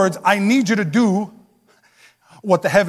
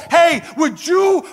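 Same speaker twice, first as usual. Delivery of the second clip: 1 calling out; 10 semitones higher, slower than usual. Acted. God calling out invitation.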